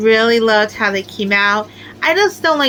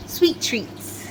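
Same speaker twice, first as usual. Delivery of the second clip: whispered; 3 semitones higher, 7 dB quieter than usual. Real, not acted. Whispered aside about sweet snails.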